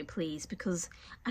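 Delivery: slow, breathy whispered plea; whispering. Real, not acted. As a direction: beg in a whisper. Pleading with other parents.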